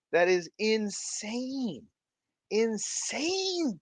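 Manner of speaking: high, slow, amazed exclamation; be blown away. Retold or imitated. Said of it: "And I'm like".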